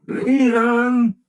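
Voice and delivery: crying out. Acted. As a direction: lead on shouted high and loud. Scrooge repeats, crying out.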